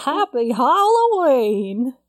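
slow, loud, drawn-out Halloween cry; spooky shout. Acted. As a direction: call it out like a ghost. Spooky holiday greeting called out.

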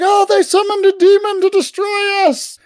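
high-pitched panicked accusation; shouting for help. Acted. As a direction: cry out in falsetto, hammed-up alarm. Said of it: "starts shouting out for help".

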